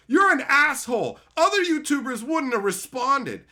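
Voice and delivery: loud, high indignant retort; mock angry insult. Offended commenter replying to a YouTuber, mock angry insult. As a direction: snap back as the offended commenter, loudly.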